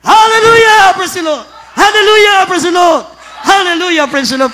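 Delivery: high loud shouts while jumping; shouting while jumping. Real, not acted. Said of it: "Sabay-sabay sumigaw habang tumatalon".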